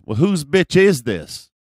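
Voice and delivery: loud, high challenge; confrontational guy impression. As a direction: imitate the tough guy demanding to know. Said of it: "do my best impression of this guy".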